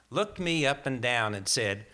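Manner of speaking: ordinary delivery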